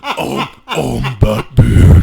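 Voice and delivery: slow, loud, laughing superhero impression; hammed-up hero growl while laughing; mock Batman voice. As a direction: drag it out like a joke Batman.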